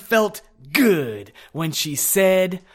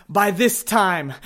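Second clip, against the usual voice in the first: ordinary delivery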